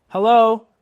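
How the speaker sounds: very loud, high holler back; answering shout. A narrator's reading. Guard answers the coachman, shouted reply.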